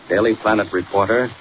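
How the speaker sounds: ordinary talk, nothing marked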